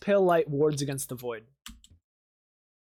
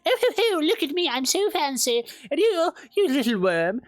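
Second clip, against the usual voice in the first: snooty goddess voice; 9 semitones higher than usual. Retold or imitated. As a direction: play a vain pompous goddess. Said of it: "She's like".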